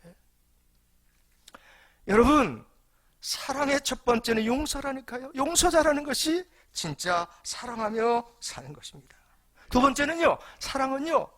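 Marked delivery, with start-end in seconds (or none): 2.1-11.3 loud, high, emphatic sermon delivery; rising fervent preacher's call; preaching at full force. Acted. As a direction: preach it out to the whole congregation. Preacher driving home his point.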